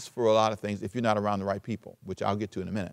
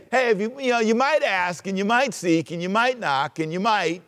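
higher, casual, noncommittal maybes; mock wishy-washy; 7 semitones higher than usual. Preacher mocking a noncommittal Jesus, higher. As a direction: toss it off lazily. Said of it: "He didn't say".

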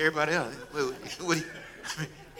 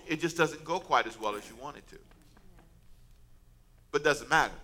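ordinary talk

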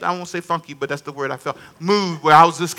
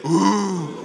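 drawn-out, softer demonstration ooh; crowd's wincing ooh; 7 dB quieter than usual. Shown as a demonstration. Pastor getting congregation to echo onlookers, imitated ooh. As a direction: lead the room in a long sympathetic ooh. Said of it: "Come on, say".

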